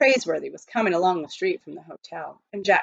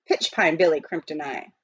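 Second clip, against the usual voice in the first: ordinary delivery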